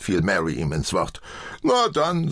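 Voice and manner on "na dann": resigned sigh, starting high. A narrator's reading. Old man gives up arguing, sighing. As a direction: give in with a sigh. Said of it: "seufzte er".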